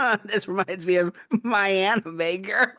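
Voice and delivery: high-pitched mock character voice; Otacon. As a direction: imitate a pants-wetting anime nerd, pitched well up. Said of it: "when he pees his pants and he's like".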